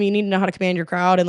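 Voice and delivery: ordinary delivery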